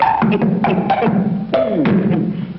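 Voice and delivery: evil laugh. Acted. Villain gloats.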